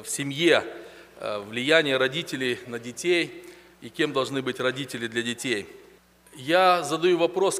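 ordinary delivery

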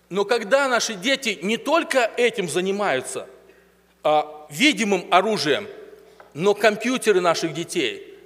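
ordinary talk, nothing marked

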